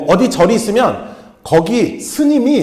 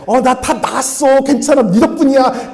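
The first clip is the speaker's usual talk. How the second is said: mock fake thanks; 8 semitones higher, 3 dB louder than usual. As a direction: drag it out like a smooth-talking con. Mocking people who fake being healed.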